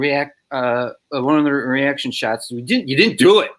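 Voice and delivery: high-pitched, halting, flustered stammer; nervous stammer. Retold or imitated. Extra botching his correction to the director, flustered high stammering. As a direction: trip over every phrase in panic.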